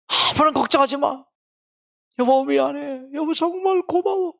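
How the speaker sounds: from a hushed thank-you to a high, loud tearful outpouring; husband crying on phone. Retold or imitated. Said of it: "남편이 울면서 전화기로 하는 말이".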